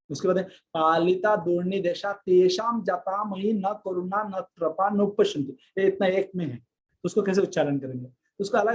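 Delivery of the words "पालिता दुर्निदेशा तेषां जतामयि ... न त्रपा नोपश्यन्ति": ordinary talk, nothing marked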